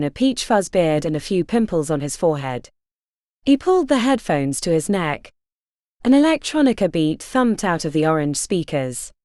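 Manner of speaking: plain reading voice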